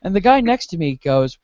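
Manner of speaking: ordinary delivery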